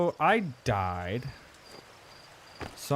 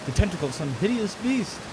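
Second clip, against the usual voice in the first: spooky storytelling; 3 semitones higher than usual. Acted. Storyteller spooking the listener with a campfire tale. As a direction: build the tension, lean on the mystery.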